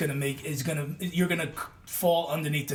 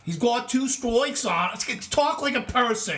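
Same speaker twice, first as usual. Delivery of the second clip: lightly mocking Boston broadcaster; mock Boston accent; 7 semitones higher than usual. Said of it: "That awful Boston accent that he has".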